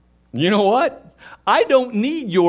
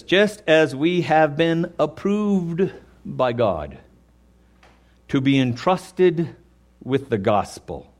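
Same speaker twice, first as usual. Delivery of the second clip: slow scripture reading; 6 semitones lower, slower than usual. Acted.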